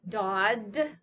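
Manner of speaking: Dodd said with a D where the G should be; fronted dodd. Shown as a demonstration. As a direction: Dodd like a child who can't say G yet. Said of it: "for dog".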